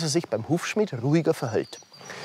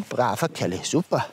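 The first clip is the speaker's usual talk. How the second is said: low, soft praise for the pony; low soft praise for the horse; tender praise; 8 semitones lower, 3 dB quieter than usual. Real, not acted. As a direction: coo a reward to the horse.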